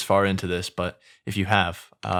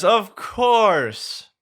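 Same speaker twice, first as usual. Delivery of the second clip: sarcastic; 11 semitones higher, 4 dB louder than usual. Acted. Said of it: "Shrek sarcastically says".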